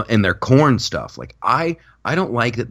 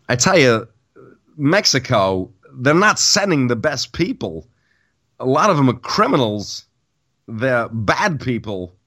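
Trump lines in a light Walken cadence, higher; Christopher Walken as Trump; 4 semitones higher than usual. Retold or imitated. Comic pitching his Christopher Trump bit to his cohost, lightly done. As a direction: deliver the Trump quote with Walken pauses. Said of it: "I can do Christopher Walken".

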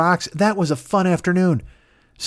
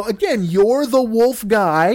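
high, slow, stretched-out words; acted exasperation, pitched up; exasperated explaining; 7 semitones higher, 3 dB louder, much slower than usual. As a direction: spell it out patiently for an idiot. Family spells it out.

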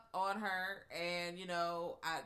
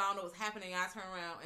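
usual speaking voice

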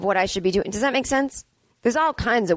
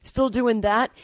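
mocking, higher nosy voice; snide nosy questioner; 4 semitones higher, slower than usual. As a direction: imitate a judgy dentist asking about touring. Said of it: "people who go".

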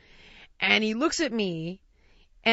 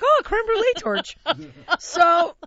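much higher, bright mock delight; mock excited recognition; 9 semitones higher, 7 dB louder than usual. Ex-fat kid spotting the dessert torch, higher mock delight.

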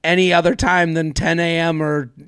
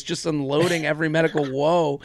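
ordinary delivery

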